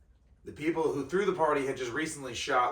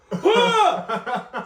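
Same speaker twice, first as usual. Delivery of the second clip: high, loud laughing scream; retold scream; 12 dB louder than usual. Guest acting out partygoers' startled shriek.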